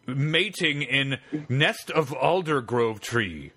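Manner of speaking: slow, drawn-out hammed-up chief voice; mock Native chief. Prank caller asks the researcher for help, slow mock chief. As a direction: drag every word like a cartoon chief. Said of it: "tell him it's heap big skunk ape".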